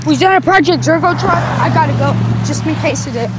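loud, high panicked rush. Real, not acted. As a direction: blurt it out in alarm. Panicked sighting of a truck.

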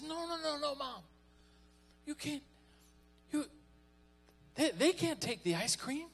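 put-on high pleading little boy; despairing child. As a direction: beg like a desperate five-year-old. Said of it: "in utter despair".

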